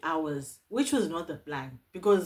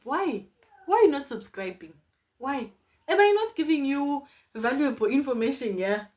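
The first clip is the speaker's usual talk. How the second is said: high, louder playful protest; mock scolding; 7 semitones higher, 6 dB louder than usual. Vlogger chiding viewers who haven't subscribed, mock scolding. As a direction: plead indignantly, half joking.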